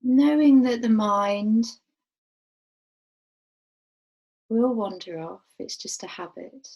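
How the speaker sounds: calm, unhurried meditation voice with long pauses; guided meditation. Real, not acted. Teacher guiding a group to rest awareness on the breath, guided meditation. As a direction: guide them gently, letting each phrase settle and fall away.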